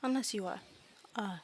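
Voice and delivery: soft, halting fairy voice; quiet little fairy explaining its tastes; matter-of-fact fairy. Acted. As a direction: state it simply with long hesitations.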